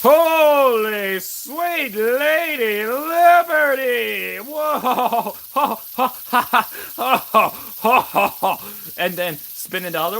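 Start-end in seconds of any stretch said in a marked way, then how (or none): 0.0-9.0 high, loud, thrilled shout with laughter; too excited. Real, not acted. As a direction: burst out in real delight, slow and loud. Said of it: "I'm getting too excited".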